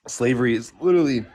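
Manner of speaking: talking while choking on water; strained voice, choking on a sip of water. Real, not acted. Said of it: "sorry, I'm choking on water here".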